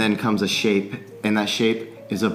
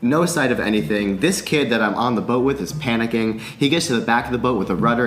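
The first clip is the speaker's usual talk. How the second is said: ordinary delivery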